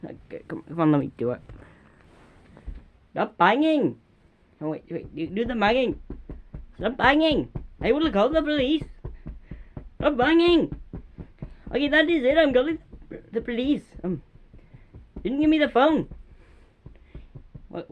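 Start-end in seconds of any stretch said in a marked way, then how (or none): 3.1-4.0 pretend angry yell. Kids role-play angry neighbour. 6.8-13.8 loud, higher pretend yell at the noisy neighbour; pretend angry neighbour. Neighbour yells at noisy Jamal, loud and angry. 15.2-16.1 loud, higher indignant complaint; pretend angry complaint. Neighbour protests about the phone, loud and higher.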